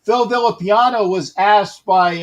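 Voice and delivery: ordinary talk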